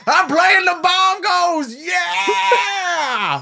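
wild, very high drawn-out holler; dad yelling onstage. Acted. Drunk dad on stage calling to his son, ecstatic shout. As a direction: holler it exuberantly from the back of a bar. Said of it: "hear the dude in the back going".

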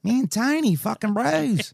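high, drawn-out Australian impression; Australian accent. Podcaster mimicking an Australian skate host, slow high Australian accent. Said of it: "he is Australian".

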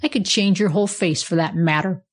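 usual speaking voice

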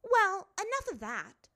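high, loud brush-off; dismissive. A narrator's reading. Azula waving the matter away, dismissive. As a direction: cut the subject off loudly. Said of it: "waving her hand dismissively".

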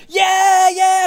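high loud fake cheering; mock happy fans. Mocking fans who cheer once they see the camera. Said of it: "they start going".

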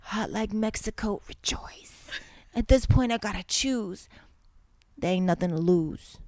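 dramatic lyric read in a hammed-up Jersey voice; mock Jersey accent, dramatic. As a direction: read the lyrics like a Jersey girl, over the top. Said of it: "if you're in a Jersey voice".

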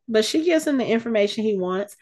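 ordinary delivery

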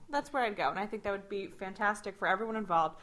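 usual speaking voice

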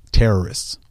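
ordinary delivery

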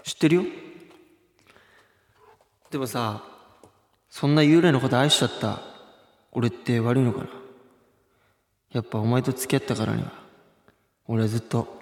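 low, soft heartthrob voice; a hushed, low lover's promise; low romantic boyfriend. Acted. Acting romantic boyfriend reply skit.

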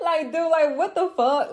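high, laughing mock disgust; laughing scoff. Telling women how to laugh off a soulless guy, high laughing scoff. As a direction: laugh him off as a loser.

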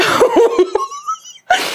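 very high squealing laugh; laughter. Real, not acted. Host cracking up after her own rant, laughter.

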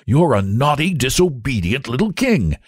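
high-pitched telling-off; angry scolding. Acted. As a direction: scold a naughty child crossly. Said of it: "he was very angry indeed".

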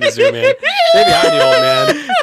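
high, loud laughing wail; words dissolving into shrieking laughter; helpless laughter. Real, not acted. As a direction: crack up while speaking. Cracking up over their own misreading.

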